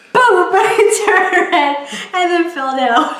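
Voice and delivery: high, loud words through laughter; laughing hard while telling it. Real, not acted. Retelling falling into the girl, laughing loudly.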